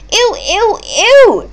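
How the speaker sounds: high, squeamish repeated ew; picky plant squealing in disgust; grossed-out squeal. Acted. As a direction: shudder at the thought of bugs. Venus disgusted at bugs.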